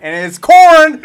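slow, loud high shout. Acted. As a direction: belt out the punchline. Shouting the corn joke.

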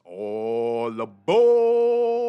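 long, drawn-out booming call; conductor's call. Pretend. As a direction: stretch it out like a train conductor. Conductor calls passengers aboard again.

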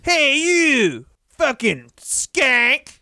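very slow, high-pitched mock wrestler taunt; Barry Darsow. Host imagining a wrestler's promo, slow mock taunt. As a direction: drag out each insult in a high put-on voice. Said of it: "it should have been Barry Darsow coming out being like".

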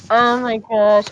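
normal talking voice

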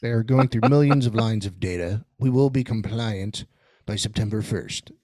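flat, low, mechanical announcement; robot voice. Pretend. Speaks as the Sentioid robots.

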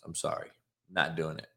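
soft, dropped-away refusal; quiet refusal. Real, not acted. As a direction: say it under your breath. Repeats refusal, first take soft.

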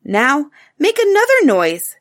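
put-on a child proud of her idea; proud little girl. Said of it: "very proud".

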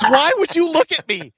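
high, loud protest; mock panicked pastor. Worship pastor scolding a colleague, mock panicked. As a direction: squeal it out. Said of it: "He goes".

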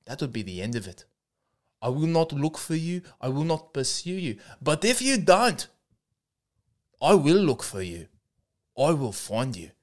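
put-on a Taken monologue in a South African accent; menacing threat read in a South African accent; mock South African tough-guy accent. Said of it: "It's the Taken monologue as performed by myself impersonating".

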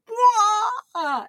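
high laughing wail; burst of laughter. Retold or imitated. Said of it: "And went".

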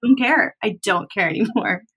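ordinary talk, nothing marked

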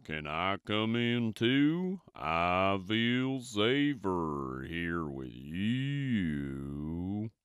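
slow, soft cow voice; a gentle cow drawing out each word. A narrator's reading. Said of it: "said Cow".

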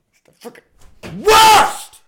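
slow, very high, very loud scream; frustrated scream. Real, not acted. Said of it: "These notes are the worst".

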